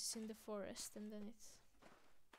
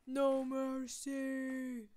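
loud shout, very slow and stretched; mock-epic war cry, dragged out; drawn-out battle cry; 2 semitones higher, 8 dB louder, much slower than usual. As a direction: bellow it with long held vowels.

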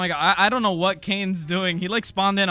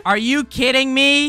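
loud, high, stretched-out disbelief; exasperated outburst; 7 semitones higher, 7 dB louder than usual. Acted. Player fed up with partner falling, loud exasperated outburst. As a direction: explode slowly in exasperation.

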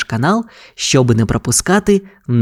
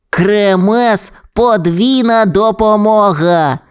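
higher puppy voice, drawn-out catchphrase; Кремез; 7 semitones higher, slower than usual. A narrator's reading. Bulldozer pup starts clearing roads, slow high exclamation. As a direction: exclaim it slowly like a proud pup. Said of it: "вигукнуло щеня".

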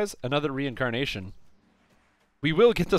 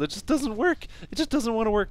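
frustrated whine; 9 semitones higher than usual. Real, not acted. Build keeps failing, complaining.

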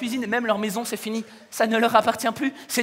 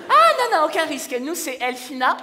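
smug parent; 4 semitones higher than usual. Acted. Voicing smug proud parent.

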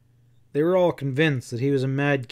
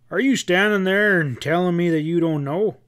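loud, higher, angry outburst; exploding in anger; 5 semitones higher, 6 dB louder than usual. A narrator's reading. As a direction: let it burst out of him in disbelief. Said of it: "Nate Turner exploded".

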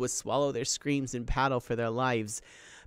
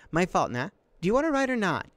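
loud, high, challenging comeback; indignant retort; 7 semitones higher, 6 dB louder, faster than usual. Acted. Sister in canoe to brother in the water, indignant retort. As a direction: throw the blame back, pitched way up.

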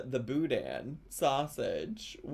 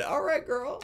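put-on hype girl; 9 semitones higher than usual. Hyping up the cook.

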